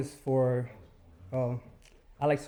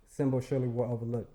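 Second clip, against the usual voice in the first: ordinary talk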